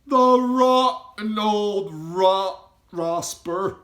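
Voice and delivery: high, loud, slow outraged giant; indignant giant cry. A narrator's reading. Giant cries out against the cruel orphanage matron, slow and loud. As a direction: spit out the insult slowly and loud. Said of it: "cried the B F G".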